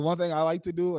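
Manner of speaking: ordinary talk, nothing marked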